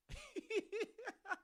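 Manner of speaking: a sudden high-pitched laugh; real laugh at a funny comment; high burst of laughter. Real, not acted. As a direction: crack up, high and breathy. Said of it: "that's too funny".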